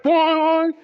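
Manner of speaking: high, loud cartoon boing; spring the sound up like a cartoon; imitated bounce noise. Coworker watching the dolls bounce, high cartoon boing.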